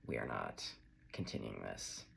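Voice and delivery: weary boulder pusher giving up, low; lightly voiced Sisyphus. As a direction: grumble the refusal. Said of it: "being like".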